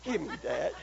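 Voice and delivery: soft, low, offhand thank you; a hint of an unimpressed receiver; mild mock thanks. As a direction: say it without any joy. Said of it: "you said".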